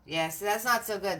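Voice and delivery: normal talking voice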